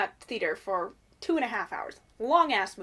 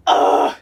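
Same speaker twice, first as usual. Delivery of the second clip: loud exasperated groan; 16 dB louder than usual. Real, not acted. Overwhelmed fan outburst.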